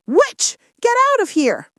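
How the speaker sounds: high, loud shout of rejection; angry shout. A narrator's reading. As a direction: shout it high, driving her away. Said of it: "the owner shouted".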